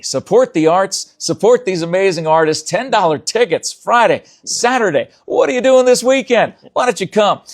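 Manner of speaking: rally the viewers, rising to the big question; hyped sales pitch. Real, not acted. Host's closing promo pitch.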